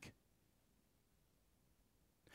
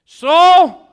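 loud, high call of the name; 26 dB louder than usual. Acted. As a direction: shout the name across the camp. David calling out to king.

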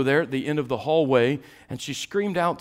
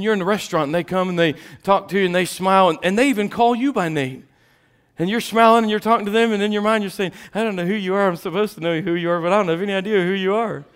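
lively preaching; 9 semitones higher, 7 dB louder, faster than usual. Acted. Excited preaching about forgetting names.

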